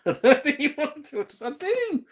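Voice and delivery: laughing high through the words. Real, not acted. Streamer laughing while reading chat. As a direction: giggle the line out.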